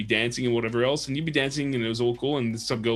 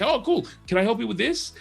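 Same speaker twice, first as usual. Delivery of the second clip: high, eager mock friendliness; friendly keen; 11 semitones higher than usual. US film student offering help, eager mock friendliness. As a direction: pitch way up, all helpful.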